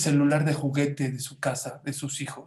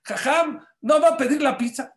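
put-on much higher, loud kid voice; mock child voice; 11 semitones higher, 6 dB louder than usual. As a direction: chirp it like an eager pupil.

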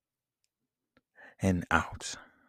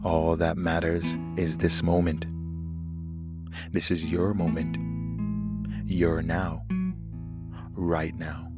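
calm and soothing over the music. Acted. Leads a guided meditation.

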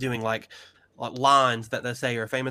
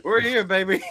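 excited; 6 semitones higher, 5 dB louder than usual. Real, not acted. Finally recording the long-planned episode.

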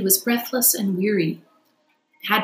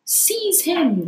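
higher shouting command; cried out; 10 semitones higher than usual. Acted. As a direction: yell to seize him. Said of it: "they cried".

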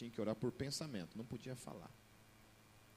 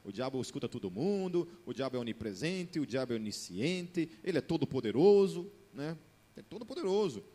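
building to loud mockery; 3 semitones higher, 8 dB louder than usual. Building mocking list.

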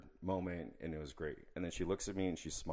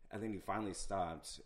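ordinary talk

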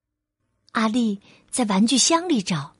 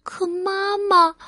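usual speaking voice

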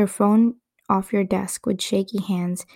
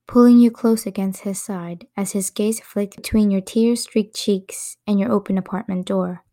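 plain reading voice

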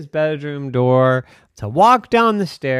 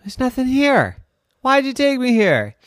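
higher, let-down whine; higher whiny boy; disappointed complaint; 10 semitones higher, 3 dB louder than usual. Acted. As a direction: complain you were dragged here. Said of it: "he said".

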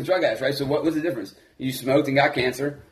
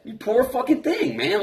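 heartfelt pity; 8 semitones higher, slower than usual. Real, not acted. Voicing pity for addicts.

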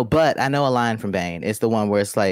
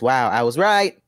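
usual speaking voice

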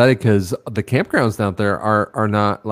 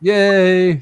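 excited; 9 semitones higher, 4 dB louder than usual. Retold or imitated. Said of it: "And I was like".

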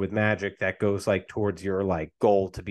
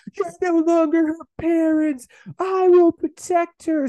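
very high, loud falsetto imitation; hammed-up dramatic declaration; mock heroic defiance; 5 dB louder than usual. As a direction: overact a protective anime brother. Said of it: "Lance was like".

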